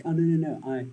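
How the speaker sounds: high, loud sneering put-on voice; mock a pompous online debater backing out; mocking whiny debater. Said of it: "they're like".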